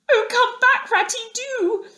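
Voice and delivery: very high, loud, sobbing plea; pleading cry. A narrator's reading. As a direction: beg him desperately to come back. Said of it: "cried the Mole".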